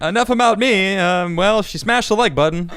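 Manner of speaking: high, loud mock deflection; hammed-up chirpy sign-off; playful mimicry. Host parrots guest's deflection.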